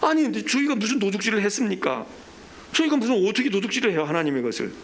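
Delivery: high, acted protest of innocence; indignant protest. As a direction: push back as the wrongly accused people. Voices people protesting God's accusation.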